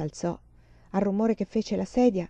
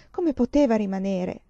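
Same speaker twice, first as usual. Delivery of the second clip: ordinary delivery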